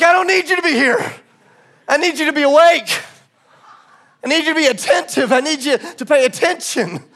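high, insistent rebuke with punched key words; heated retort. Retold or imitated. Preacher confronts the guard he hired, heated and insistent.

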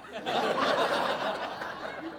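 a high, airy, real laugh; real amused laugh at his own joke; breathless laugh. Real, not acted. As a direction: laugh out with no voice behind it.

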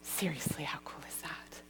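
hushed excited whisper; breathless whispered aside. Real, not acted. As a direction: lean in and whisper it like a secret.

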